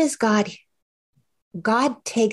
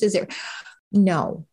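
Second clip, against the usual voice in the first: ordinary talk, nothing marked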